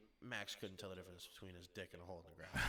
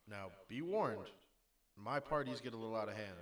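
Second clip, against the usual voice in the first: mock warning; 6 dB louder than usual. Warning Max, mock serious.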